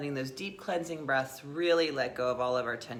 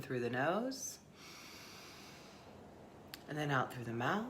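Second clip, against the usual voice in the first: soft, unhurried guiding voice with long pauses; guided meditation; 3 semitones lower, 8 dB quieter than usual. Acted. Teacher leading listeners into the breath, guided meditation. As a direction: ease listeners inward, softly and gently.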